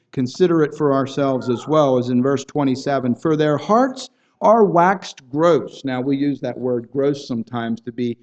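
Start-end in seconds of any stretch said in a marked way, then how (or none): 3.1-5.8 scripture reading. Acted. Reading scripture verse aloud.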